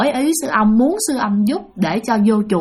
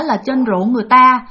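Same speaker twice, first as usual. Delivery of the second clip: ordinary talk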